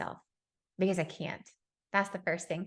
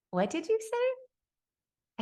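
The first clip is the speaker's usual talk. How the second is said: stunned; 14 semitones higher, much slower than usual. Retold or imitated. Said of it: "I got down on my knees and I said".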